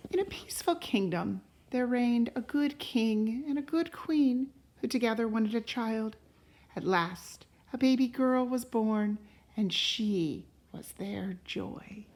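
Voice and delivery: unhurried read-aloud voice, rising on the opening lines; storybook narration. A narrator's reading. Parent reading a fairy tale to a child, gentle storytelling. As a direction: tell it like a bedtime story to a child. Reads a picture book aloud.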